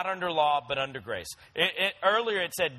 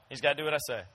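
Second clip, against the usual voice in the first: normal talking voice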